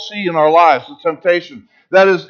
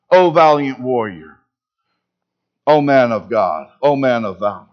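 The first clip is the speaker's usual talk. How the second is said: lower, gentle, lingering on each O; tender affirmation; 8 semitones lower than usual. Acted. God sees what Gideon can be.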